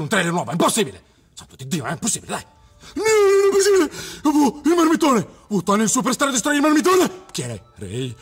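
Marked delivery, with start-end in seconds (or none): 2.9-3.9 high, loud disbelieving cry; can't believe what you're seeing; shocked outcry. Real, not acted. Streamer stunned by new trailer. 4.2-7.3 high, loud thrilled exclaiming; excited fanboy. Real, not acted. Excited reaction to trailer shots.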